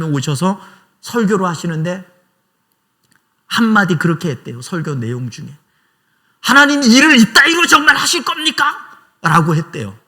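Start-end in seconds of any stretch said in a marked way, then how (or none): 6.4-8.8 loud, much higher indignant shout; retold preacher shouting his frustration at God; bold outcry. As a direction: hurl the complaint straight at God.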